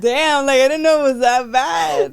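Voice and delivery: mock shocked shout. Friend hamming up her shocked reply to the argument. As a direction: blurt it out in exaggerated shock.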